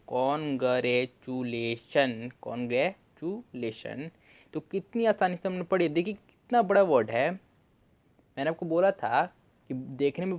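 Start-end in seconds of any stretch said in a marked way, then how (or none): 0.1-4.1 congratulation drawn out slowly, syllable by syllable; slow full reading. Shown as a demonstration. Teacher reading the whole word slowly to students. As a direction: read it out slow and clear for the class. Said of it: "तो चलिए अब हम पढ़ते हैं".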